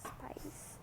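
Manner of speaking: breathy whispered word. Acted. Vlogger ending a silly chant, whispering. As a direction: whisper it almost silently.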